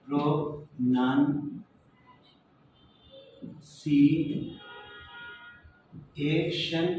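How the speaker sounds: "প্রোনাউন্সিয়েশন" in very slow detached syllables, nun in the middle; right sounds, no accent. Shown as a demonstration. Teacher showing correct sounds without accent, very slow syllables. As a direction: spell out "প্রোনাউন্সিয়েশন" syllable by syllable, unaccented. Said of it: "প্রোনাউন্সিয়েশনটা ঠিক আছে".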